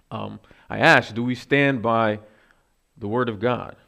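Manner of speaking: ordinary talk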